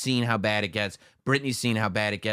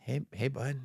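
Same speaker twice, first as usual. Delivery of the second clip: imitating Genuine; 2 semitones higher, 7 dB quieter than usual. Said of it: "Genuine would be like".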